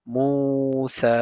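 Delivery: মুসা with the সা drawn out one alif long, slowly; madd lengthening. Shown as a demonstration. Teacher shows students the long সা in মুসা. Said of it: "এটাও ল-, এক আলিফ লম্বা হবে".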